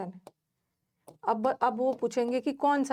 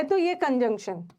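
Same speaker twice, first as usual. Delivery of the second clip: usual speaking voice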